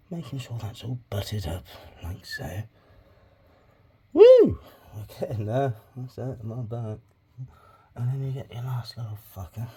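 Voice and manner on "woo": whoop. Real, not acted. Cheering progress.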